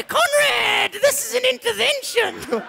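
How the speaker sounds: a wild puppet wolf yelling; high puppet voice. Acted.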